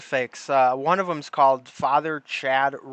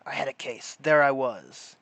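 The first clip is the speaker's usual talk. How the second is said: noir detective; 4 semitones lower than usual. Pretend. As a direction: brood like a tired gumshoe. Mocking a noir detective monologue.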